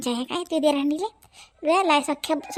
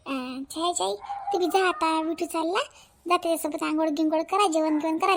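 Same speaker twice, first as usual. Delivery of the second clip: ordinary talk, nothing marked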